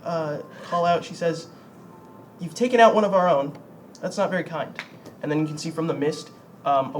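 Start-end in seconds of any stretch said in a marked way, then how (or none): 2.4-4.7 higher, louder calling voice; woman's voice. Acted. Game master as woman scolding the player, lightly voiced woman. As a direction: lightly raise the pitch for a woman. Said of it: "you can hear the woman's voice, call out".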